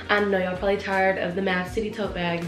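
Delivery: usual speaking voice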